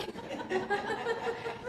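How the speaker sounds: high burst of disbelieving laughter; a real high incredulous laugh. Real, not acted. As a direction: laugh off the absurd question.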